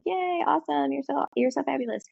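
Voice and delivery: put-on high, bright-eyed praise for a toddler; cheering praise. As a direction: cheer her on like a proud parent. Cheering on the potty-training toddler.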